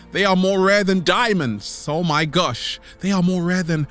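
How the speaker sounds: usual speaking voice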